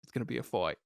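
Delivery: Australian "fight" said "foy"; a mock Aussie threat; bad Australian accent. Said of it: "no more bad Australian accents".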